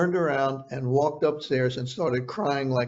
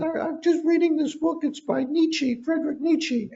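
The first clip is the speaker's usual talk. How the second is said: Mike Tyson impression; 13 semitones higher than usual. Interviewer imitating Tyson showing him a book, high voice. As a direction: lift the voice way up to mimic the boxer.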